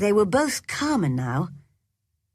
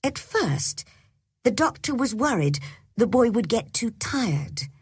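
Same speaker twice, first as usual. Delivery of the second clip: usual speaking voice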